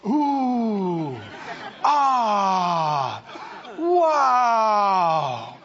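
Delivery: breathless ooh, ah, wow, half crying out; overwhelmed with wonder, voice catching; awestruck gasps. Acted. Believers marvelling at God's goodness, crying out in awe. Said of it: "and we'll all go".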